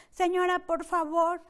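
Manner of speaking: a mild mock plea from the officer; imitating the court officer. Court officer pressing the mother to let go, hint of an impatient plea.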